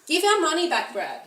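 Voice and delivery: exasperated outburst; angry demand. Real, not acted. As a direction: insist hard on getting the money back.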